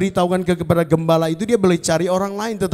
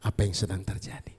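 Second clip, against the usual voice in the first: lower, softer gentle voice of God; voice of the Holy Spirit; 10 semitones lower, 10 dB quieter than usual. Retold or imitated. The Holy Spirit gently answering the doubting preacher, low and soft. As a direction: speak down to him like a patient father. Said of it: "Roh Kudus bilang di sini".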